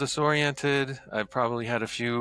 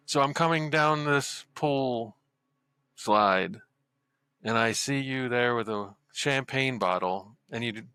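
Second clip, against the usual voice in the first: ordinary talk, nothing marked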